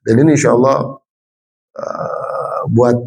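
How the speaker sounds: normal talking voice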